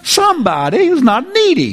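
high, swooping mock exultation; exaggerated relief. Pastor reacting to a good testimony, exaggerated joyful relief. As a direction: sing it out high with playful amazement.

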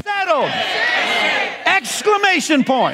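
high, shouted preaching; fiery preaching. Acted. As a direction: preach it to the congregation at full force. Preaching at full force.

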